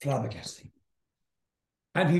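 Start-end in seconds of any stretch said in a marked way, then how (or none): 0.0-0.7 stunned hush. Retold or imitated. Bandmate's stunned one-word reaction onstage.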